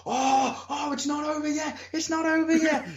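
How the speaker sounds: high, hopeful crowd cheer; excited crowd. Retold or imitated. Wrestler voices crowd hoping match continues, high eager cry. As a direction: lift your voice like fans sensing a comeback. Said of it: "crowd getting like".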